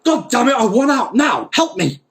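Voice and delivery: high, furious shouting; loud, frantic demand to be let out; screaming. Acted. Uncle Dima stuck in the jar, screaming for help. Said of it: "he was screaming".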